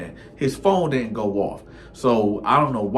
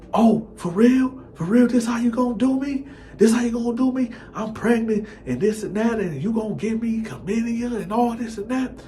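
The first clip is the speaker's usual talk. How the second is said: put-on furious girlfriend; 12 semitones higher than usual. Girlfriend tears into her man, high-pitched mock rant. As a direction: go in on him like a wronged girlfriend.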